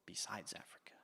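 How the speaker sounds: soft stage whisper, a hint to the room; hushed quizmaster hint. Acted. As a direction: whisper the clue as a secret. Quizmaster hinting to the kids.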